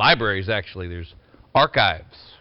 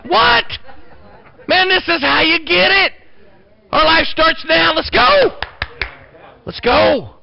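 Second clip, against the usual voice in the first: preaching at full force; 8 semitones higher than usual. Acted. Pastor rousing his church with raised preaching voice. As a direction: fire up the congregation, voice raised.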